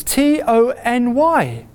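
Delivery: T O N Y spelled slowly, one letter at a time; punching each syllable. Acted. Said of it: "spell it to you in four letters".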